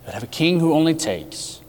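normal talking voice

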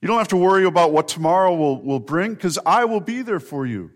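usual speaking voice

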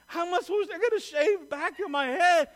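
high, loud whine, as if about to cry; hammed-up wail of dismay; mock near-tears. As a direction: play up being close to crying. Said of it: "I'm about to cry".

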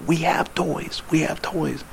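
barely voiced, mouthed words; mouthing silently. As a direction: mouth it without sound across the room.

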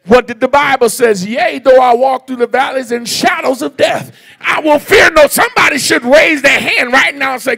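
loud, climbing preacher's call; preaching at full force. Real, not acted. Pastor rousing the church about the pandemic, loud preaching. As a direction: drive the congregation to praise, full voice. Preacher quoting psalm, urging praise.

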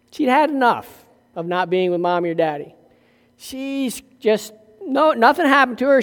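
ordinary talk

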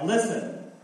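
high, loud call to listen; listen up, sentinels. Acted. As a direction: call out to the watchmen on the wall. Reading prophet's call to sentinels.

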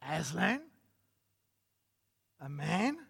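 incredulous echo, rising at the end; stern. A narrator's reading. Mister Beaver answers Lucy's question, stern. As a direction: repeat it as a stern, disbelieving question. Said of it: "said Mister Beaver sternly".

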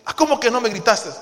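pregunta aguda y fuerte, de pleito actuado; réplica indignada. Acted. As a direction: contraataca con el reclamo. Acted spouse fires back. (indignación de pareja, actuada)

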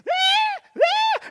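very high-pitched mock wail; hammed-up squealing sobs; mock crying. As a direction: wail like a big crybaby. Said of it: "we cry, and we mumble and complain".

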